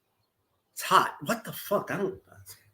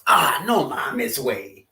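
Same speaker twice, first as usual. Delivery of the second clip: slow, let-down "no mames way"; mildly annoyed Mexican-slang complaint; disappointed groan; 4 semitones lower, 4 dB quieter, slower than usual. Retold or imitated. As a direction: sigh it like plans just fell through. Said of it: "friend told plan delayed".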